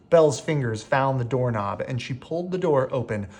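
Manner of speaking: ordinary delivery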